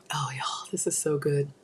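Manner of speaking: hushed, breathy whisper over food; savoring whisper. Real, not acted. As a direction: whisper it like it's heavenly.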